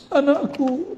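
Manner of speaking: high-pitched parent's lament, hammed up; mock tearful wail. Mother crying as her child runs off, mock tearful. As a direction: pretend to weep for a lost child. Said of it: "nangis-nangis".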